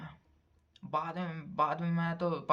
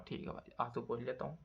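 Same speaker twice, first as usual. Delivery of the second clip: much softer, meekly giving in; 5 semitones lower, 10 dB quieter than usual. Retold or imitated. Sold boy obeying his new boss, softly and meekly. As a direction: say it small and defeated. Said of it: "आंसू पोंछ लो".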